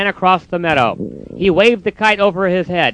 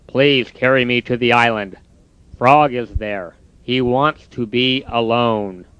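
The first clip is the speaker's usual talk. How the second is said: glum Toad; 5 semitones lower than usual. Acted. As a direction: drag out the giving up. Toad's voice.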